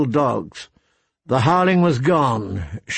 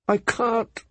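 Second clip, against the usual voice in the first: much higher tearful refusal; weeping mother refusing to sleep; 9 semitones higher, 4 dB quieter than usual. Acted. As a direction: cry it out helplessly. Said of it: "she wept".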